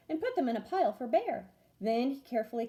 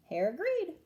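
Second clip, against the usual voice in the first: plain reading voice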